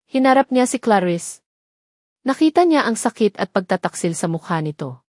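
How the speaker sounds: usual speaking voice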